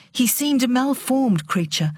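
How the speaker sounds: usual speaking voice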